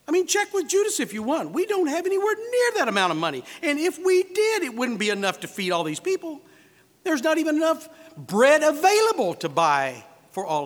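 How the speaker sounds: high, pleading protest; worked-up, high-pitched acted complaint; exasperated objection. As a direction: argue the absurdity with rising exasperation. Acting Philip's exasperated objection to Christ.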